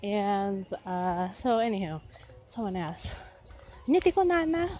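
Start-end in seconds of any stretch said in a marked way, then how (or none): 3.9-4.7 quoted question. Retold or imitated. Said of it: "what did he say?".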